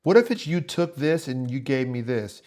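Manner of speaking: ordinary talk, nothing marked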